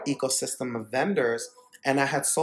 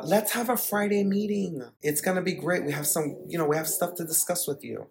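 higher, sing-song office cheer; mock-friendly invitation; 5 semitones higher than usual. Managers setting up an ambush meeting, mock-friendly and high. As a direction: imitate a boss casually luring someone in. Imitates bosses luring him to meeting.

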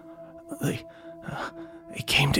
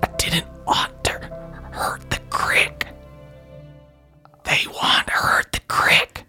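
tearful whisper; 3 dB quieter than usual. Acted.

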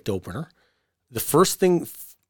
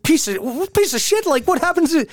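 high, exasperated mock outburst; angry rant. Partner vents about the wrestler in a high, exasperated mock outburst.

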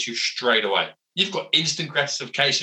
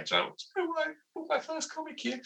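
much higher, hushed, far-off voice; high mock voice; 10 dB quieter, faster than usual. Man at the old sweet shop, high and hushed. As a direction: pitch it way up like a giddy grown-up.

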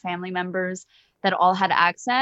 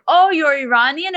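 higher and louder, imitating a cab driver's surprise; mock surprise; 7 semitones higher, 8 dB louder than usual. Cab driver learning she's Iranian, mock surprise. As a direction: play the driver's eager surprise.

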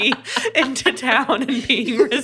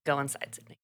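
quiet low voice; 6 semitones lower, 12 dB quieter, faster than usual. Retold or imitated. Quoted man sending her inside.

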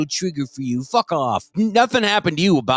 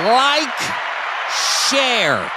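mock-stern shouted plug; 8 semitones higher, slower than usual. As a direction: break the hush with a drawn-out holler. Loud plug after mock silence.

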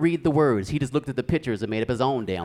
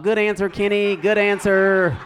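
higher, drawn-out congratulation; game-show cheering; 6 semitones higher, 5 dB louder, slower than usual. Acted. Brothers high-fiving like on Family Feud, mock cheering. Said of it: "like they was on Family Feud".

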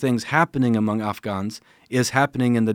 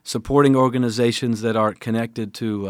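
ordinary delivery

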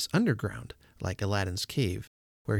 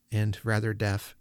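usual speaking voice

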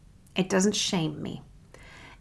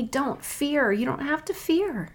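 softer, higher, tender voice; gentle reassurance; 7 semitones higher, 4 dB quieter than usual. Acted. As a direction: reassure them softly, with pitch lifted. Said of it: "He does not talk to me like that".

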